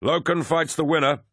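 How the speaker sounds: high-pitched ringside cry; lively, high announcement to onlookers; excited cry. A narrator's reading. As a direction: cry it out to the crowd. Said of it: "Torgadon cried".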